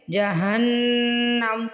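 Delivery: جہنم with a long nasal نّ, held in the nose; nasal ghunna. Shown as a demonstration. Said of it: "جہن پہ پہلے تھوڑی دیر لگائیں گے". (جہنم میں نون پر غنہ)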